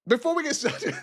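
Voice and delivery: high, breaking into laughter; real giggles overtaking the words; laughing. Real, not acted. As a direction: start the line and crack up. Breaks up laughing, then restarts.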